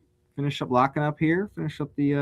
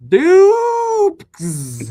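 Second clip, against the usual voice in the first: squeaky name call; 8 dB louder than usual. Real, not acted. High playful name call.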